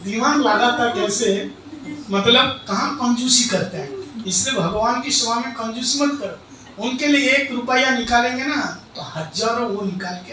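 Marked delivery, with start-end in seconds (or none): none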